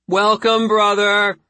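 high, loud shouted welcome; screamed greeting. Acted. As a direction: scream it in chorus. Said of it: "they screamed".

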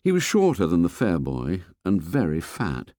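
plain reading voice

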